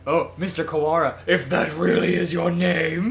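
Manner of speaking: hammed-up imitation of a venomous villain; mocking. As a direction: drag it out, dripping mock doubt. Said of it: "It has always sounded like he was mocking him".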